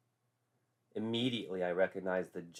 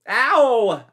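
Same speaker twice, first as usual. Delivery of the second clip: sudden high yelp of pain; 18 dB louder than usual. A narrator's reading. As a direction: cry out at the crushing grip. Painful handshake.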